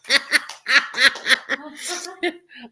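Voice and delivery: long, high burst of laughter; real amused laugh. Real, not acted. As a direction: crack up at a joke. Amused by the sorry joke.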